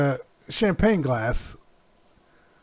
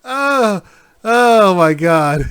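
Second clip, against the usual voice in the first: shocked gasp; 4 semitones higher, 8 dB louder, much slower than usual. Real, not acted. Reacting to funny picture.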